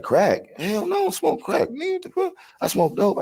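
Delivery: high mock-offended woman's voice. The woman insisting she smokes dope, high offended voice. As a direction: play her indignant and high. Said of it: "She like".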